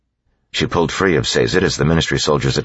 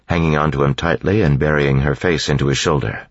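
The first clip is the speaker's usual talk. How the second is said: usual speaking voice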